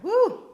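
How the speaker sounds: high, loud exhausted whoop; winded "woo". Retold or imitated. Retelling two runs up the stairs, high winded whoop. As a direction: let out a high imitated "woo" of exertion. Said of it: "I'm just like".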